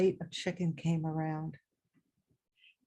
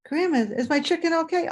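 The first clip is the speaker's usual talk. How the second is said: higher, anxious little voice; worried child; 7 semitones higher, 4 dB louder than usual. Retold or imitated. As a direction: ask with a child's worry. Worried child asking grandma.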